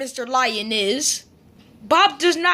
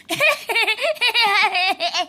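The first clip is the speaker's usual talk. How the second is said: long high giggle; giggling; 5 semitones higher than usual. Acted. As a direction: burst into a high, playful giggle. Becca giggles after scare.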